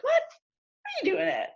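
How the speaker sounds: high, drawn-out disbelief; shocked sister. Retold or imitated. Sister hearing she dates a Mexican man, high and slow in disbelief. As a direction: stretch it out high, scandalized. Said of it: "she was like".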